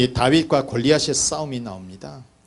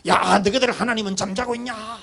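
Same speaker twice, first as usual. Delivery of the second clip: mock jeering; 6 semitones higher than usual. Goliath mocking the army's God, high jeering taunt. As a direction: jeer at them as a hammed-up giant. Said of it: "야유하고 있는 거예요".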